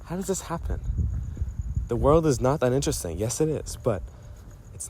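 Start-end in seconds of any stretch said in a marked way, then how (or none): none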